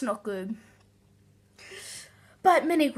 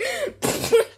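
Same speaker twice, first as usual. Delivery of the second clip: high squealing laugh. Real, not acted. Laughing at the spit take.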